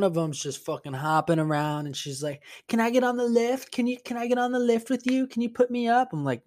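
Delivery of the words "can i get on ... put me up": imitated high, eager drunk lady; drunk woman. Drunk lady begs painter for a ride on the lift, high excited imitation. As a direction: pester like an overexcited drunk woman, high-pitched. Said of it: "drunk ladies".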